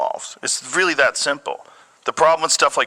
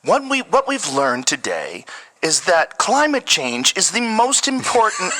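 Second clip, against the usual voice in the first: mock news anchor; 5 semitones higher than usual. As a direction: play a stiff cable news anchor lecturing. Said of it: "How would he talk?".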